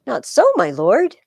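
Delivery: slow, loud denial; urgent protest. Acted. Volumnius to Brutus, loudly protesting. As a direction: plead against his despair.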